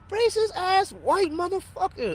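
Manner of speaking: high, slow scornful jeer; scornful insult. Retold or imitated. Telling a friend what he thought of the landlord, scornful and high. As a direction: sneer the insult up high and slowly.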